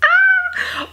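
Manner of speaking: loud, very high scream of disbelief; high-pitched shriek. Real, not acted. Vlogger shrieking at the red flags, loud and high. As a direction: shriek in exasperation.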